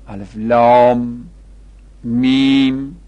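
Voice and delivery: لام and میم held long on a level note; prolonged recitation. Shown as a demonstration. Teacher listing Quran opening letters, prolonged recitation. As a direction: hold لام and میم steady, loud and long.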